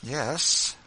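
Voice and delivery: a touch of nervous tremble; tremulous. A narrator's reading. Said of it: "Eliza tremulously answered".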